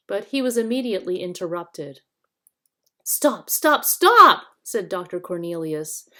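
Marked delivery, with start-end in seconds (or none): 3.0-4.4 rising to a loud cry; shouting stop. A narrator's reading. Doctor halting the quarrel, shouting. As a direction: cut them off, louder each time. Cornelius cries out to halt.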